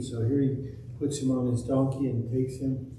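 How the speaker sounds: usual speaking voice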